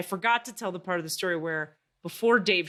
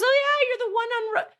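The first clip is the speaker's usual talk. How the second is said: cheerful guy; 5 dB louder than usual. Retold or imitated. Retold mail carrier's cheerful reply.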